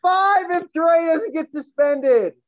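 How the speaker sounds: shouting. Real, not acted. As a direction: yell it out, dragging it. Heated sports debate outburst.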